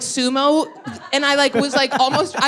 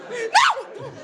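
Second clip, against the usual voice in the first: high laughing no; 12 semitones higher than usual. Real, not acted. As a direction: squeal it through a laugh. Laughing denial.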